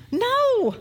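ordinary talk, nothing marked

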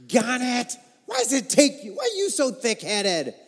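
a put-on fed-up rebuke, slowing right down at the end; exasperated scolding. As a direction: scold him for being so thick-headed. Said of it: "No, Jesus does not say this".

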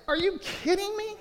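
high, disbelieving are you kidding me; incredulous. Real, not acted. Pastor reacting to a stingy tithing question, incredulous. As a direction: throw up your hands in disbelief.

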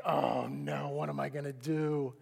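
low, soft, sinking despair; helpless dismay. Retold or imitated. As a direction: sag into a low groan of defeat. Stuck, retelling his despair.

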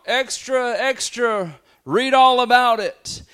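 high, loud street hawker cry; newsboy calling out. Retold or imitated. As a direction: shout the headline to sell papers. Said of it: "they would cry out".

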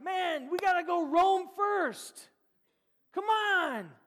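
loud, high, drawn-out holler; mock rallying shout. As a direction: yell it like a hammed-up crowd chant. Absurd rallying cry by Jesus' tribe.

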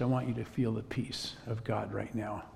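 softly spoken blessing; gently, quietly offer peace to a stranger; soft comfort. Retold or imitated. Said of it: "face right down to her ear".

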